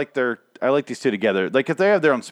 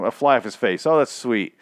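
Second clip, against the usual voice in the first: ordinary talk, nothing marked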